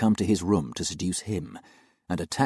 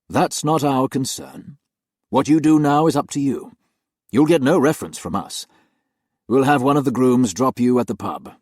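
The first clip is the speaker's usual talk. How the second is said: stern master of the house; 5 semitones higher, 6 dB louder than usual. Acted. Master tells the nanny to leave by tonight, stern and cold. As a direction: lay down the terms coldly. Harry dictates her dismissal.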